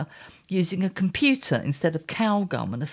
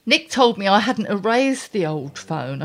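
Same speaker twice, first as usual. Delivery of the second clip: ordinary talk, nothing marked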